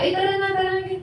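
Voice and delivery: high, teasing sing-song invite; sing-song coaxing. Retold or imitated. Old barkada coaxing a reformed friend to join, in a sing-song tease. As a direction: lightly chant it like a pushy drinking buddy. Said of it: "kikinukulit ka pa nila".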